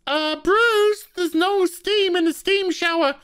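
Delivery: put-on high, airheaded girly voice; a bimbo whining about the shower; ditzy girl voice. Girlfriend whining at Bruce about the shower, high and airy. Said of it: "comes down and says".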